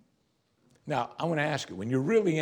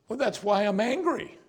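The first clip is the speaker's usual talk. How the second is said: higher-pitched indignant excuse; mock self-justification; 8 semitones higher than usual. Preacher mimics a believer excusing anger, mock-indignant. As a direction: act out a defensive protest, pitched up.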